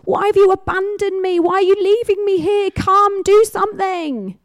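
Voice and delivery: high, loud anguished cry; Jesus crying out on the cross, high and loud. Acted. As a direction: cry out to God in agony from the cross. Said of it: "he's crying out".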